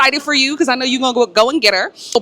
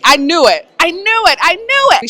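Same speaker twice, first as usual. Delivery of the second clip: building excitement; 8 semitones higher, 4 dB louder than usual. Real, not acted. Excited about spoiler twist.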